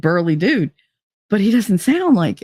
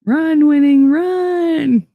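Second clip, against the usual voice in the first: loud, high call to run; cheering; 10 semitones higher, 4 dB louder, much slower than usual. Real, not acted. Yelling at streamer Winning to run, loud cheer. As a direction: urge him on like a race crowd.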